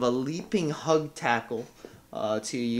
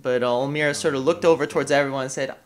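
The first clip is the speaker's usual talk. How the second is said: usual speaking voice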